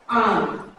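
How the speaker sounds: आम as English "arm" without r; long open aa straight into m in आम; low, soft, isolated आम, no r; silent r. Shown as a demonstration. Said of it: "यहाँ पे आर जो है ये".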